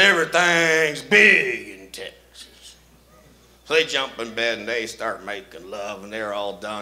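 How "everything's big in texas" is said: slow, drawn-out boast; drawled Texan boast. Acted. Lover bragging in bed, slow drawl. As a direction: stretch it out like a proud Texan.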